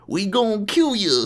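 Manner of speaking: high, slow put-on tough-guy voice; mocking impression. Captor mimicking the prisoner's way of talking, mocking. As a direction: sneer at how he talks. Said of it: "or as you would say".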